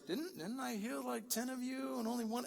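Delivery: mock snappy sarcasm. Jesus asking after the nine he healed, snappy and sarcastic. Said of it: "He's so snappy".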